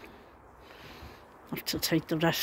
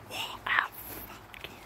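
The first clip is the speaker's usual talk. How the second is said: under-the-breath whispered curse; whispering; 10 dB quieter than usual. Real, not acted. Muttered curse at storm damage.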